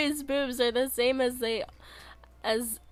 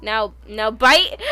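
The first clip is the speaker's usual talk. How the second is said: lightly voiced tough act, louder; playful growl; 2 semitones higher, 6 dB louder than usual. Streamer play-threatening chat with a mock growl.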